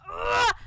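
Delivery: loud, high yell of exasperation; angry "ugh". Real, not acted. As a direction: explode in a wordless angry shout. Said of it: "I'm so mad".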